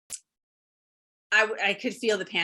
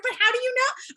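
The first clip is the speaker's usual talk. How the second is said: much higher, an incredulous challenge; pleading disbelief; 13 semitones higher than usual. Retold or imitated. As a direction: push back in disbelief. Panicked friend pushes back.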